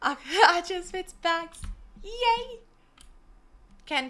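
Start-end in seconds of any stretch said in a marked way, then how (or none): none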